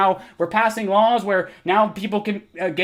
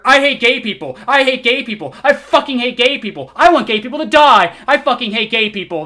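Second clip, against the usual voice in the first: loud, higher sarcastic rant as the commenter imagines him; mocking caricature; 5 semitones higher, 7 dB louder than usual. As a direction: shout it like a cartoon bigot, mocking the misreading. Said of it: "And then somehow here".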